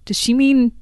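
high, loud baffled questioning; mock bewildered double take; puzzled. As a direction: sound genuinely confused by the phrase. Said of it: "I was just like".

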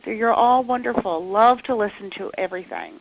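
normal talking voice

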